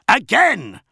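one explosive, high-pitched roar; bellowing. A narrator's reading. Commander demanding another shot, bellowing. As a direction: bellow it as a repeated order. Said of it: "bellowed Namet Jira".

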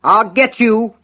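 high, loud enraged scream; full-voiced furious shriek; screamed threat. A narrator's reading. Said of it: "Buster screamed back".

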